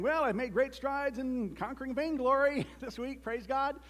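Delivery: high, mock-pious brag; hammed-up lobby testimony; boastful churchgoer. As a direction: put on a self-satisfied churchgoer, chuckling midway. Parodies boastful lobby chat.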